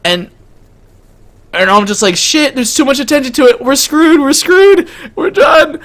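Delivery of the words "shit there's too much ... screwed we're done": high-pitched, escalating mock panic; panicked outburst. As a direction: climb into a panicky squeal of doom. Acts out panic at growing attention.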